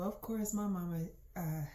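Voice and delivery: usual speaking voice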